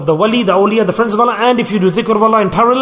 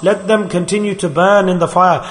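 normal talking voice

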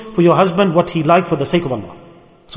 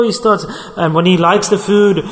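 normal talking voice

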